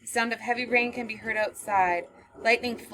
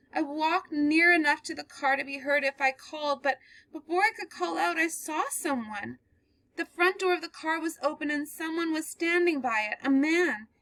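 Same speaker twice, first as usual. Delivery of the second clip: higher, slowing as the horror sinks in; growing dread; 8 semitones higher than usual. Acted. Girl describing the man dragging a body, in dread. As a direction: relive it as you tell it. Girl tells her story.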